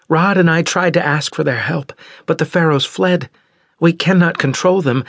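put-on high, tense young woman's voice; young Osira. Daughter explaining the pharaohs to parents, high and tense. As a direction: lift into a high worried girl.

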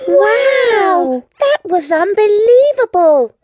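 very high, loud, excited cry; amazed cheer. Acted. Girl reacting to the dog's theme song, amazed and loud. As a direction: exclaim in wonder.

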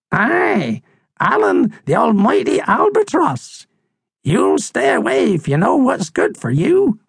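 put-on high, swooping old-bird voice; old crusty seabird, warning. Seabird warns them away from the albatross, high and ominous.

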